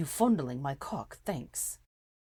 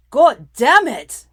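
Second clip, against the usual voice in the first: slow, much louder roar; 8 semitones higher, 20 dB louder, much slower than usual. A narrator's reading. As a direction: roar it up at the heavens. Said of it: "Draco roared".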